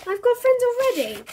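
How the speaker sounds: put-on indignant boy. A narrator's reading. Bertie tells Mum he has friends, indignant. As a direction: sound offended like a kid.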